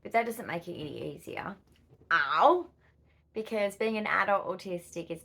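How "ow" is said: sudden pain. Real, not acted.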